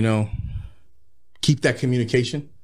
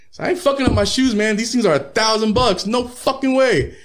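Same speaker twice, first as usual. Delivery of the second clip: normal talking voice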